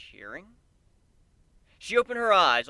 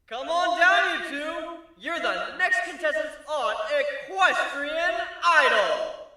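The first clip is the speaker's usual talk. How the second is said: high, loud announcer shout; game show shout; 14 semitones higher, 7 dB louder, slower than usual. Acted. Host summoning contestants, high and loud like a game show.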